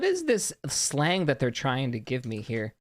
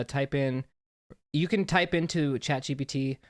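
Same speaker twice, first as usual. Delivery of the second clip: ordinary delivery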